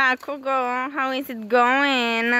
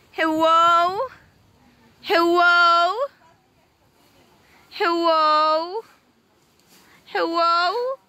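calling out; 6 semitones higher, 6 dB louder, slower than usual. Real, not acted. Calling out from behind the fence.

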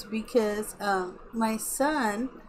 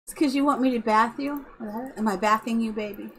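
usual speaking voice

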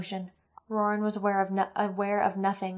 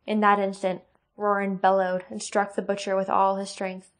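ordinary delivery

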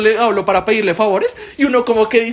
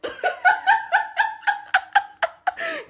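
risa larga y aguda; 5 dB quieter than usual. Real, not acted. Laughs after the dog startles.

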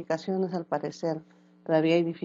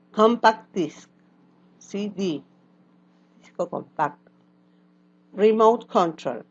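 vocabulary drill; 4 dB louder than usual. Shown as a demonstration. Teacher drills English words for students to repeat, loud. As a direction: model each word for the class to echo.

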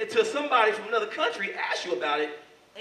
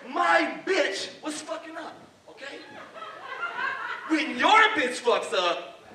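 high, slow, deliberate put-on delivery; acted aggressor's voice; 8 semitones higher, slower than usual. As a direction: argue slowly as the indignant attacker. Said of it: "He says".

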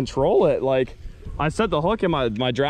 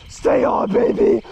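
urgent excited plea; 4 dB louder, slower than usual. Real, not acted. Fighting the fish, urging it to stay on, excited. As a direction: beg the fish to stay hooked.

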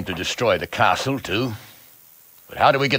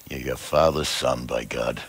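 chuckling through the words, lower and softer; amused laugh; 8 semitones lower, 6 dB quieter than usual. Acted. Lord pleased with the lad's plan, laughing. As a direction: laugh fondly as you praise him.

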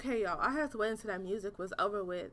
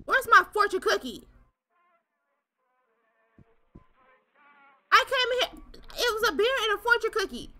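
higher, louder outraged demand; indignant shout; 8 semitones higher, 5 dB louder than usual. Real, not acted. Gamer yelling at the game about his fortune cookie, outraged shout. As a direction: yell for the missing fortune cookie.

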